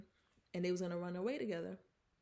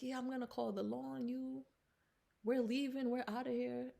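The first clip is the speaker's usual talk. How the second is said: a mother voice pitched higher, curt; lightly voiced mother storming off; haughty mother; 4 semitones higher than usual. Mother telling Bayou they're leaving, higher and curt.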